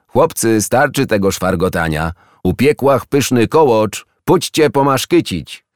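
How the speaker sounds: loud, high Silesian call to the table. A narrator's reading. The aunt calls the boys to eat cake, loud Silesian call. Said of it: "ciocia zawołała".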